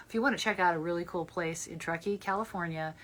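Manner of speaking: ordinary talk, nothing marked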